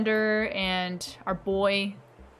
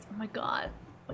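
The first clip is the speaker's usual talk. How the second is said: whisper; 11 dB quieter than usual. Real, not acted. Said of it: "whispered reaction".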